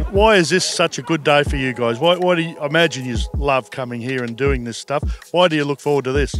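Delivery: ordinary delivery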